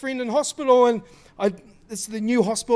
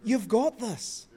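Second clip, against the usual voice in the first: ordinary talk, nothing marked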